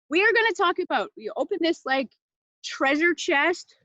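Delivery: ordinary talk, nothing marked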